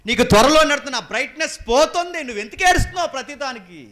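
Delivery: high, loud scolding from the pulpit; loud rebuke. Real, not acted. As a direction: thunder the rebuke at the listeners. Preacher shouts rebuke. (ఎత్తైన స్వరంతో గట్టిగా మందలింపు)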